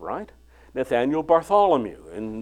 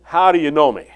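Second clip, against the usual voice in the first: How leaned on, falling, wary; a guarded, doubting How do you know me; suspicious; 7 dB louder than usual. Shown as a demonstration. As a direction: ask it with suspicion. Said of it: "ask it suspiciously".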